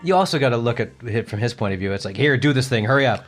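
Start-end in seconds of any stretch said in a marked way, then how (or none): none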